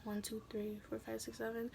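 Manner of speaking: soft breathy count; quiet counting. Retold or imitated. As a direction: count under your breath.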